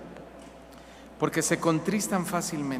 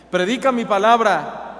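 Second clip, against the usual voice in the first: voice of God; 6 semitones higher, 10 dB louder than usual. Acted. God's commands voiced.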